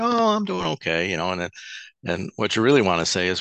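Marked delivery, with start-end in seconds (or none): 0.0-1.1 high, put-on cheery brush-off; fake fine. Hungover man faking fine to a coworker, high and chirpy. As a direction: sound fine when you're not.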